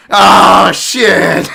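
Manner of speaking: very loud, high-pitched yell of disbelief; shocked shout. Acted. Fight fan reacting at home to a matchup, shocked shout. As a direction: blast it out in mock shock.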